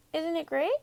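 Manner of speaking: put-on high, quick, cheerful young sheep voice; Wilbur the lamb. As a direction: chirp it like a proud little lamb.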